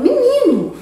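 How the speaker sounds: "Menino" exclaimed high and loud, falling; a surprised, high "Menino", dropping at the end; a loud spoken exclamation, "Menino". Shown as a demonstration. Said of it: "a gente fala".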